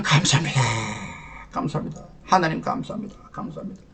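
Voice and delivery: very soft prayer fading into a whisper; whispered thanks. Retold or imitated. Platoon leader slumped down thanking God, fading whisper. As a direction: murmur it to God, sinking to pure breath. Retold prayer of relief after standoff.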